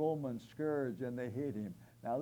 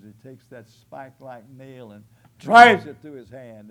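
loud preaching burst; 21 dB louder than usual. Real, not acted. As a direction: swell loud on the nail. Describing nail driven through hand.

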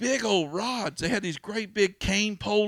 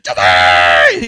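low, loud possessed roar; demonic scream; 11 semitones lower, 18 dB louder than usual. Retold or imitated. Possessed girl screaming at the exorcist. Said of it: "she was hollering and screaming".

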